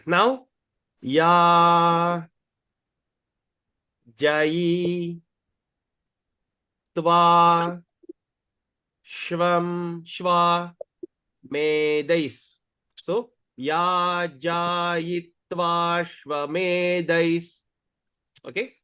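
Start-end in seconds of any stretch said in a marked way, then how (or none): 1.0-12.6 drawn-out syllables with long pauses; slow syllable demo. Shown as a demonstration. 13.5-17.6 slow, joined-up chanted syllables; slow pronunciation demo. Shown as a demonstration.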